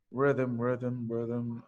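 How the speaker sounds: low, soft musing to oneself; lightly acted pupil mulling over a word; student pondering. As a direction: repeat it low and quietly, thinking. Teacher voices student thinking it over.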